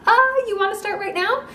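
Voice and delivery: a startled, half-mocking question; incredulous. Dentist starts before numbing sinks in.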